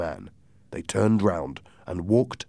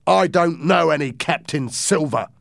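angry; 8 semitones higher, 6 dB louder than usual. A narrator's reading. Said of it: "in an angry voice".

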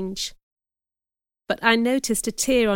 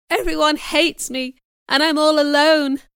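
high, raised, plaintive wail; mildly acted sobbing; 6 semitones higher, 4 dB louder than usual. Girl crying to her friend after punishment, plaintively sobbing. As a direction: wail it as if in tears. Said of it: "I sobbed".

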